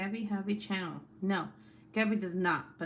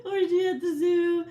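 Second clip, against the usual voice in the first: much higher and louder, cracking up; laughing; 11 semitones higher, 8 dB louder than usual. Real, not acted. Streamer reads viewer's rude rhyme aloud, laughing. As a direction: barely get it out through hard laughter. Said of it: "I can't even say it".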